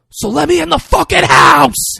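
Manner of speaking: sudden high, loud scream; Mark Wahlberg scream. Retold or imitated. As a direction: explode into a scream. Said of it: "Yeah, screams".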